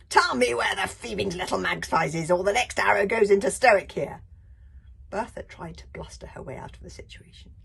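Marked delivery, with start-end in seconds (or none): 0.1-4.2 loud, higher demand; menacing threat. Acted. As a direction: threaten with the bow. Librarian threatens.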